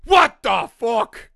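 loud, high, drawn-out yell; outraged mock shout. Podcaster acting out the father jumping up, mock outraged shout. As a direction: bellow it slowly in hammed-up outrage.

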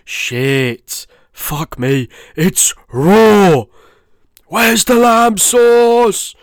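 hammed-up raging chef, building to a high yell; Gordon Ramsay. Host retrying his chef impression, building to outraged shouting. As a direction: start contained, then bellow like a furious TV chef. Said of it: "He's the only impression I wanna do".

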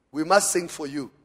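ordinary talk, nothing marked

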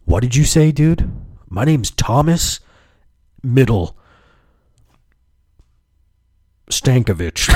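mock indignation. Misgendered customer correcting the caller, mock indignant. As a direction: announce the name in hammed-up offence.

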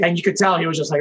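ordinary talk

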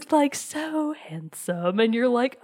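ordinary talk, nothing marked

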